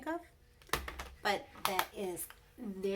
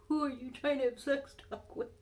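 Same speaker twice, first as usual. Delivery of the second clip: higher, louder jealous demand; mock angry husband; 7 semitones higher, 5 dB louder than usual. Wife imitating angry husband's demand, higher and louder. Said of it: "he was so mad".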